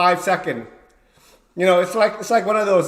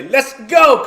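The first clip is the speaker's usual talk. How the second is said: higher, louder fight start call; referee start call; 7 semitones higher, 7 dB louder than usual. Retold or imitated. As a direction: start the bout like a ref. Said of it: "'cause I'm the referee".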